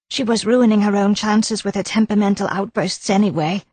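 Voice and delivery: protest, then gossip a little smugly; nervous wife. A narrator's reading. Wife denying she went near the decanter. Mrs Gower voiced higher.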